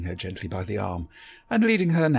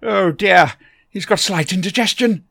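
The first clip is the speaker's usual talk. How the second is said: put-on higher and louder, fussy old gentleman; eccentric Doctor voice; 9 semitones higher, 12 dB louder than usual. A narrator's reading. The Doctor greets Barbara from behind, a higher, louder fussy old gentleman. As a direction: bustle in as a fussy, kindly eccentric.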